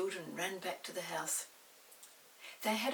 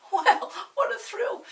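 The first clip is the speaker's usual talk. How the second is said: much higher, louder, with a laugh; thrilled; 14 semitones higher, 15 dB louder than usual. A narrator's reading. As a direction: burst out with delight. Narrator excited.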